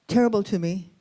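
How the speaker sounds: usual speaking voice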